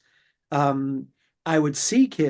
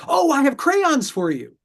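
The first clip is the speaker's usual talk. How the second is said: cooing mother; 10 semitones higher, 3 dB louder than usual. Retold or imitated. Mother swoops in to soothe.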